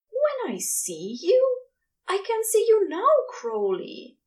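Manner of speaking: moaning Aziraphale. A narrator's reading. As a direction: moan it in confusion. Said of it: "Aziraphale moaned".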